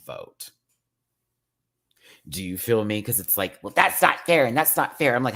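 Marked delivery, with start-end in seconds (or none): 3.6-5.1 high, loud mock complaint; mocking whine. Mimicking petty contestants, mock whiny complaint.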